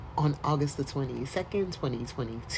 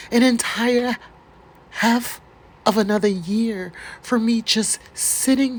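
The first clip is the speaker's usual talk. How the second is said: emphatic reading; 5 semitones higher, 5 dB louder than usual. Pretend. Reading the client's grievance letter.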